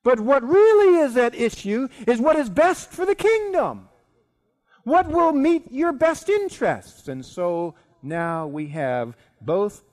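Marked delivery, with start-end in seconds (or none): 0.0-7.1 loud, high, emphatic appeal; impassioned argument. Acted. Queen pressing the king on his kingdom's good, loud and emphatic. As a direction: drive the point home at full voice. Esther presses king's best interest.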